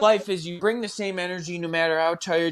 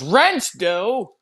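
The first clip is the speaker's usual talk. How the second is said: Paul Lynde impression; 7 semitones higher, 4 dB louder than usual. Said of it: "He sounds like Paul Lynde".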